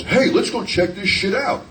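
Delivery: high, slow, drawn-out buddy voice; buddy's eager invite. Retold or imitated. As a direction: imitate a keen pal dragging you along. Friend's quote in a story.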